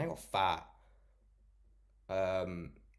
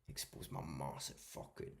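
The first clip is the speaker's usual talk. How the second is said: hushed, breathy tease about his "massive fucking"; mock whisper; 7 semitones lower, 11 dB quieter than usual. Streamer teasing chat, whispering as he takes off his hoodie. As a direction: whisper "expose my massive fucking" like a dirty secret.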